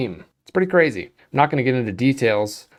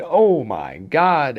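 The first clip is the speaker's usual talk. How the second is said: drawn-out, higher awe; awestruck; 4 semitones higher, 3 dB louder, much slower than usual. Acted. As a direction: stretch it out in acted amazement. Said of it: "make you go".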